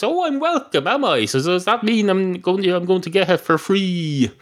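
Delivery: high-pitched affronted comeback; mock indignant. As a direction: challenge him in a high, put-out voice. Cat takes offence at barman's phrase.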